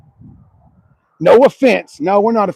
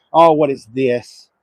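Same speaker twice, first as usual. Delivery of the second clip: ordinary talk, nothing marked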